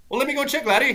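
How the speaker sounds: high-pitched put-on voice making an offer; high, friendly mock offer; Gary Player. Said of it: "Gary Player walks up and he".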